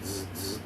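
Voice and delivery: soft, low buzz through the teeth; buzzing phone imitation. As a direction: imitate a phone vibrating on a table. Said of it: "Fucking buzzing like".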